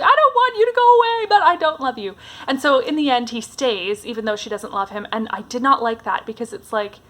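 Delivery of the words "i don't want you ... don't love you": high, rushed retold wail easing off; hammed-up tearful protest, louder then settling; mock crying plea. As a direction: imitate a distressed plea, pitched way up at first. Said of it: "crying. She's like".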